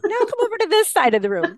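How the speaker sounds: high, quick, gleeful instruction; playful excitement. Retold or imitated. Teacher sends her assistant across the room, gleeful. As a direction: call her over, barely holding in the glee. Directing assistant to test student.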